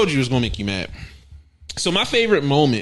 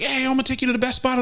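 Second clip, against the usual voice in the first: imitated friendly driver; 10 semitones higher, faster than usual. Voicing the driver in a story.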